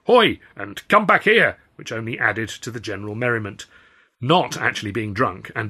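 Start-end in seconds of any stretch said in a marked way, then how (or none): 0.0-0.4 loud, high yell of hey; shouting. Acted. Steward shouting after the fleeing stowaway, loud high yell. 0.9-1.6 loud, high chasing yell; shouting. Acted. Steward chasing the stowaway, loud high shout.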